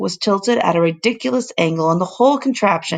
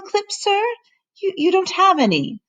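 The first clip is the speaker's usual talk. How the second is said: housekeeper voice; 12 semitones higher than usual. Acted. Housekeeper voiced, much higher.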